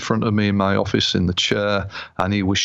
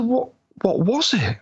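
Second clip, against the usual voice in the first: bewildered; 12 semitones higher than usual. Retold or imitated. Bewildered client.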